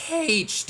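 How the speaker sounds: "H" as "haitch", leading with a strong breathy h; mispronounced H. Retold or imitated. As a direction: insist on saying "H" as haitch. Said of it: "The letter's pronounced".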